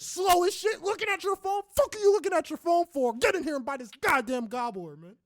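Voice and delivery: high, loud, hammed-up shouting; mock furious yell. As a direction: scream it at the imagined slowpoke. Imagined rant at slow stranger.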